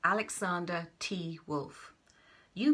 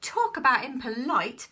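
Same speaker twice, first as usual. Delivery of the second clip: indignant; 6 semitones higher, 6 dB louder than usual. Acted. Wolf indignant at the pig.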